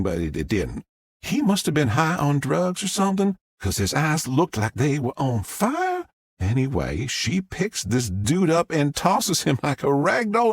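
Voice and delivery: a Southern mom gushing to the press; Southern witness. Acted. Southern witness tells story.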